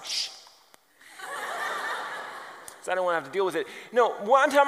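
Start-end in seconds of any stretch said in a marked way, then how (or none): 0.0-0.3 acted hushing sound; hushing shh. Preacher retelling hiding in his office, hushing. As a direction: shush them from your hiding place.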